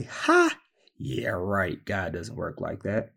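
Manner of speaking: a sharp scoffing ha, then a quiet yeah right; mock doubt, dropping off to a mutter. A hint of a doubter brushing off God's blessing, scoffing. Mocking disbelief voiced.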